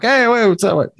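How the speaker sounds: high-pitched mock Brooklyn accent. Brooklyn toy-table shopper greeting another, in a hammed-up Brooklyn accent. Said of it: "that's my Brooklyn accent".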